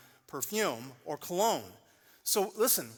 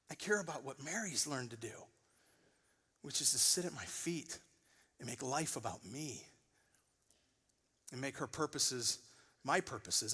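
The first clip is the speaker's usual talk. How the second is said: tender Jesus; 5 dB quieter than usual. Retold or imitated.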